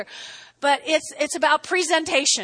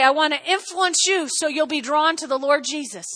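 usual speaking voice

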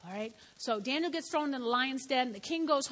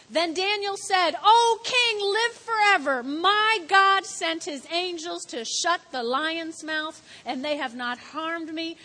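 high, loud, fervent scripture reading; loud, joyful preaching of the rescue; preaching at full force; 3 semitones higher, 8 dB louder than usual. Acted. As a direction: proclaim it to the whole church.

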